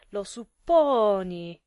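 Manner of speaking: loud, drawn-out retort; incredulous echo. A narrator's reading. Husband echoing his wife's guess, loudly incredulous. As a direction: throw it back loudly, stretching it out.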